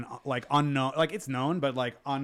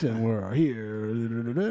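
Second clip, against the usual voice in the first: late night DJ voice; 2 semitones lower, slower than usual. Pretend. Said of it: "The creepy voice".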